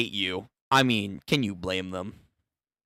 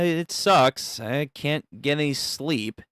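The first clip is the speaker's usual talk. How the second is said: ordinary delivery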